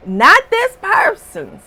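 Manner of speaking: high and loud retort; emphatic. Real, not acted. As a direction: snap the point out. Emphatic rant at dabblers.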